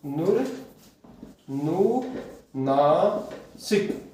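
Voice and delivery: नुर, नु, Nashik broken into slow separate syllables; नुर नु नासिक को धीरे धीरे टुकड़ों में बोलना. Shown as a demonstration. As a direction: spell the word out in slow beats for students. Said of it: "नुर नु नासिक होता है".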